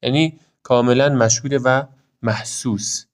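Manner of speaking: ordinary talk, nothing marked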